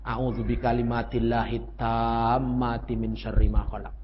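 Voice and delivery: slow, low Arabic prayer recitation; slow devout recitation; recited prayer. Shown as a demonstration. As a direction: recite it slowly and reverently. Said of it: "jangan lupa doa".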